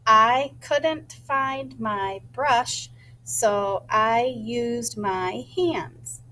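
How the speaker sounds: slow, word by word, saying the sentence to herself; rehearsing a sentence before writing it, slowly; slow rehearsed sentence. Shown as a demonstration. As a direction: spell out each word slowly so it sticks. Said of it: "So I said it to myself".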